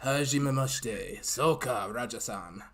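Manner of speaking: put-on slow, deliberate Japanese accent; mock-formal Japanese-accented greeting. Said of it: "until you do a Japanese accent".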